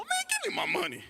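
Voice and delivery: high, fed-up exclamation; just hand it over already; exasperated. Retold or imitated. Customer to the teller, high exasperated demand.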